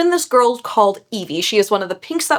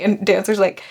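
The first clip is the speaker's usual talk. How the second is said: normal talking voice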